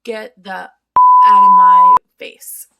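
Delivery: very loud, yelling at the ex; angry shout. Real, not acted. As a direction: blow up at him. Venting at ex-boyfriend.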